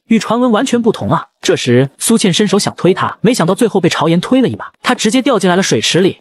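plain reading voice

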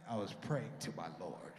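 very low, slow, solemn voice. Retold or imitated. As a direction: intone it deep and pious.

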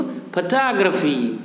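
फोटोग्राफी slowly, as "fuh-TAA-gruh-fee"; model pronunciation. Shown as a demonstration. Teacher leads pupils repeating together, फोटोग्राफी slowly as "fuh-TAA-gruh-fee". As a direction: draw out the stressed TAA in फोटोग्राफी. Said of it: "क्या बोलेंगे इसको".

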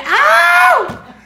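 sudden very high, loud shriek; delighted scream. Real, not acted. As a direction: let out a squeal of joy. Screams at sticker.

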